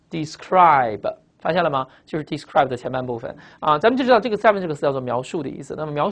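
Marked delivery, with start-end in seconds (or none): 0.1-1.1 "describe" stretched out very slowly; drawn-out reveal. Shown as a demonstration. Teacher revealing the word hidden behind descry, drawn-out reading. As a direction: draw out "describe" so its first part stands out.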